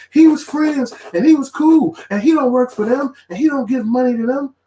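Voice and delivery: very high, whiny protest; mocking listeners who keep defending the cohost. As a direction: whine it like a naive fan. Mocking what listeners keep saying.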